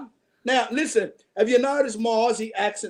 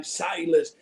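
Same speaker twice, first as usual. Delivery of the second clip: normal talking voice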